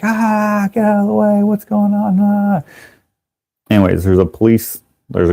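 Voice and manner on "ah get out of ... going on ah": high, thin mock screams; panicked bystanders. People fleeing the runaway tank, mock panic.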